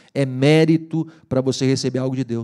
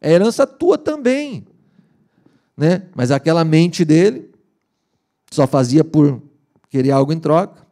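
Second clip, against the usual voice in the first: normal talking voice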